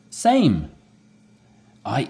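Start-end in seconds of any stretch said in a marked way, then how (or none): 0.1-0.7 same! said high and loud; eager agreement. Acted. Role-play dialogue reply.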